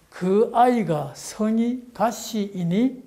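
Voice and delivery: slow sing-song 그 아이가 성이 가시이니 with the tune marked; Gyeongsang intonation. Shown as a demonstration. Lecturer models the question tune for the audience to copy. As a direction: lay out the melody slowly. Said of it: "그런 억양을 넣어서".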